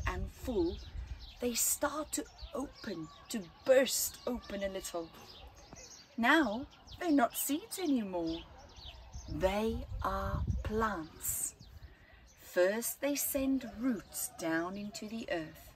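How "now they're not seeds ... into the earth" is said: usual speaking voice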